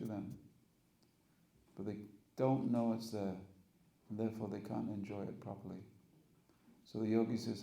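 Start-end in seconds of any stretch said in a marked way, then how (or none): none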